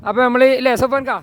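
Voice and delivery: loud, pumped-up vlog voice; energetic. Real, not acted. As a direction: turn the energy way up. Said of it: "നമ്മൾ energetic ആയിട്ടില്ല എന്നുള്ളത്".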